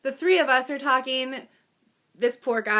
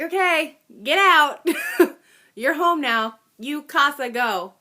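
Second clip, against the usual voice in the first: higher and louder, laughing through it; exasperated orders; 6 semitones higher, 6 dB louder than usual. Retold or imitated. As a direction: shoo him out of the car. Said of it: "And I was like".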